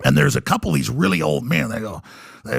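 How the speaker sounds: ordinary talk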